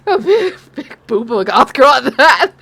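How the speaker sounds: laughing. Real, not acted. Teasing goth girl voice.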